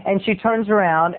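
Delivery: ordinary delivery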